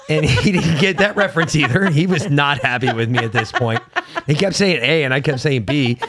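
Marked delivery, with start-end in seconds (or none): none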